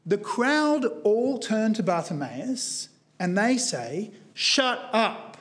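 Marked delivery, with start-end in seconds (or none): 4.3-5.3 firm, emphatic mock order to silence someone; forceful silencing. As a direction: act out a crowd's blunt command. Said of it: "quite a strong word in Greek".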